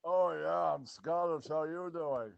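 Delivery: fake Scottish accent. Passing as Scottish to the French, in a fake Scottish accent. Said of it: "a big old fake Scotch accent".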